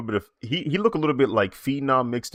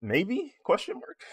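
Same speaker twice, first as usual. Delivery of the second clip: playful doubt; 14 semitones higher, slower than usual. Real, not acted. Playful doubtful aside to chat.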